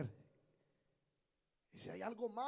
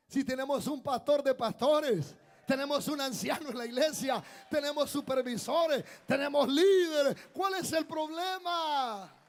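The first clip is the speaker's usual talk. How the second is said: impassioned preaching; 6 semitones higher, 10 dB louder than usual. Real, not acted. Preacher telling the church they have leaders, impassioned shout. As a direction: preach it high and loud, stretching the last phrases. Preacher rebuking the congregation's complaint.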